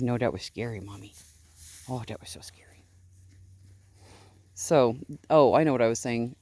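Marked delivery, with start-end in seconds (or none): 0.0-1.1 small, soft, fading sheep voice; voicing the sheep. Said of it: "He says". 1.9-2.6 much softer, soothing coo. Real, not acted. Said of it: "me blethering and baby talking".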